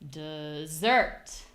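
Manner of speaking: "dessert" drawn out slowly to a strong SERT; slow low "de", punched "SERT"; exaggerated second-syllable stress. Shown as a demonstration. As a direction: stretch "dessert" with the weight on SERT. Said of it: "only difference really is the stress".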